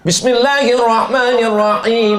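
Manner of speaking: slow melodic chanted reading; Quran recitation. Shown as a demonstration. Preacher reciting verses to the congregation, chanted. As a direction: recite it like in prayer.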